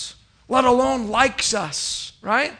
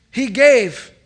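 louder, higher He gave; preaching shout; 3 semitones higher, 9 dB louder than usual. Acted. Pastor proclaiming God's gift, rising preaching shout. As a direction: ring out He gave.

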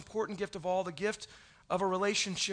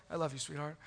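tender to wife; 6 semitones lower, 9 dB quieter, faster than usual. Retold or imitated. Saying it to his wife.

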